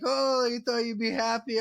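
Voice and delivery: high, mocking imitation of the guard. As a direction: sneer it back like the smug guard did. Mocking guard retold in story.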